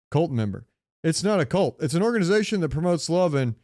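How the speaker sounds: usual speaking voice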